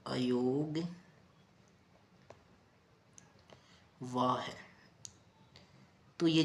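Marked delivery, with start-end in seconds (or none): 0.1-4.5 अयोगवाह stretched very slowly, syllable by syllable; drawn-out term. Shown as a demonstration. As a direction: spell out अयोगवाह slowly for the class. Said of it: "क्या बोलते हैं?".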